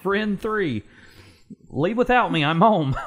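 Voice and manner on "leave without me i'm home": higher playful character voice. Acted. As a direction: read it in a high voice. Friend voiced in script.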